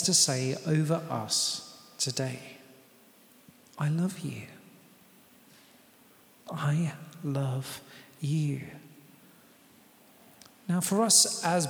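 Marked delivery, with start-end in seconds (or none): 3.8-8.6 soft whisper of love; tender whisper to the church; whispered tenderly. Acted. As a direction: breathe it like a secret. God speaking tenderly.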